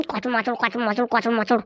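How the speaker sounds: low, rhythmic crunching syllables; comic imitation of crunching a snack; mimed munching noises. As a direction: chew noisily in make-believe, on a steady beat.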